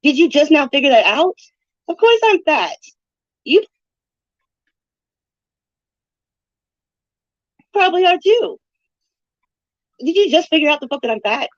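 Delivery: high-pitched sarcastic retort; mock amazement, pitched well up. Real, not acted. Streamer answering a viewer's fat jibe, sarcastic.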